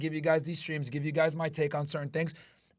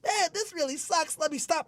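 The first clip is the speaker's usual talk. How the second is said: lightly put-on whiny quitter, higher; mock quitter; 9 semitones higher than usual. Streamer mocking people who quit at pain, whiny voice. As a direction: sound like someone giving up, half-hearted mock. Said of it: "instead of saying".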